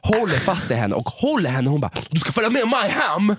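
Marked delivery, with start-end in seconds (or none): 2.1-3.3 high, insistent mock voice of a drunk stranger; pushy drunk woman. Friend mimicking the woman grabbing Sofia, high and insistent. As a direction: squeak it out like a clingy stranger.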